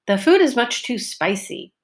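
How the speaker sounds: SPIcy long vowel, pitch up then down; focus on spicy. Shown as a demonstration. Teacher modelling focus word spicy in class. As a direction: stretch the first syllable of spicy. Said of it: "my pitch went up and down".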